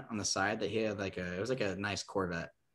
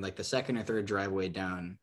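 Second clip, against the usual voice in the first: ordinary delivery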